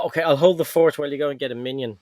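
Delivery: ordinary delivery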